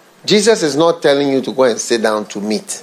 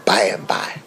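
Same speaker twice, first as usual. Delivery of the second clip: whisper; 6 semitones lower, 3 dB quieter than usual. Acted. Quoting Jesus in a sermon.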